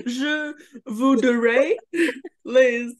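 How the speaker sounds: slow, laughing mock French; hammed-up bad French; foreign accent in French. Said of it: "trying to speak French".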